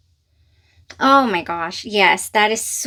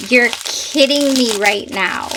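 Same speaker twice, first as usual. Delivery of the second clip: ordinary delivery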